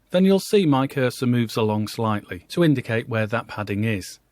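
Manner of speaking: usual speaking voice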